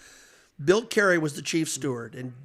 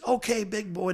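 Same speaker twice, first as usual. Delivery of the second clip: a hint of a big gravelly official, higher and coaxing; gravelly steward voice; 6 semitones higher than usual. Steward Bill Carey soothing a furious Jack, lightly gravelly. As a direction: coax the driver like a smoky old phys-ed teacher. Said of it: "He's got a gravelly voice like that".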